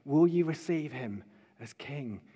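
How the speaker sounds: hushed, earnest invitation; soft appeal. Real, not acted. Preacher asking friends to receive Jesus as king, soft appeal. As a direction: drop right down and appeal gently to the listeners.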